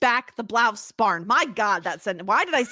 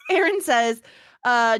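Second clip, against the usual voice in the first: ordinary talk, nothing marked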